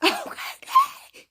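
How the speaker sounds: loud, very high, slow mock squeal; hammed-up swooning squeal. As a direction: swoon loudly in an exaggerated high voice. Repeats the swoon, hammed up, laughing.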